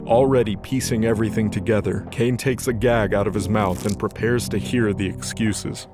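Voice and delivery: ordinary talk, nothing marked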